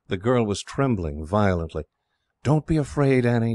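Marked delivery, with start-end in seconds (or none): none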